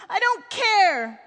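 loud, drawn-out acted wail; a child's distraught outburst; sobbing boy. A narrator's reading. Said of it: "the boy said, sobbing".

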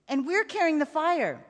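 put-on a boy checking a shared creed; earnest boy. A narrator's reading. Child seeks reassurance.

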